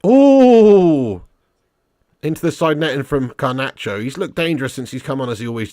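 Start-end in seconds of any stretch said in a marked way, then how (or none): 0.1-1.2 loud, high, long falling "ooh"; excited ooh. Real, not acted. Fan watching the ball hit side netting, loud ooh. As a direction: let out a big ooh at a near miss. Near miss in live match.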